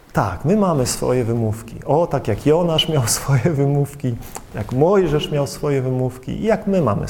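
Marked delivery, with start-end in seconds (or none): none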